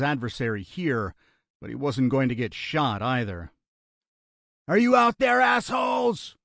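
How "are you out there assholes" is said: high, loud yell; challenging shout. A narrator's reading. Gunman calling out unseen enemies, angry shout. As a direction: holler a taunt into the distance. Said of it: "Lionel shouted".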